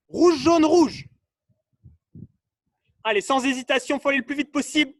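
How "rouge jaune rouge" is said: loud, high colour calls; called-out command. Real, not acted. Coach sends players to coloured cones, high shout.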